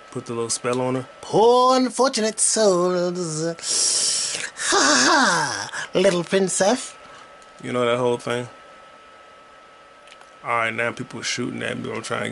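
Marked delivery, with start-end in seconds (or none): 1.2-6.9 slow, high, hammed-up sea witch with a cackle; Ursula. Recapping The Little Mermaid, hammed-up Ursula sea witch. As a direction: drag out each word like a gloating sea witch. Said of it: "'cause Ursula".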